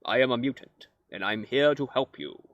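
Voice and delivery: calm, measured mentor voice; a wise mutant leader reassuring you; Professor X impression. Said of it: "That's my impression of Professor X".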